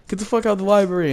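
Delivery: fast, high mock tirade; hammed-up outburst, high and quick; losing her shit. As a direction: imitate an outraged woman kicking people out. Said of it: "She lost her shit".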